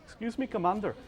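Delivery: bad Apu-style Indian accent, high; hammed-up Indian accent, deliberately bad. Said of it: "Please don't try to do an Indian accent".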